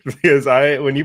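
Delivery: bursting into a high laugh. Real, not acted. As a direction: laugh it off, then talk through it. Laughing at co-host's pick.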